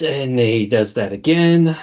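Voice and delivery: ordinary talk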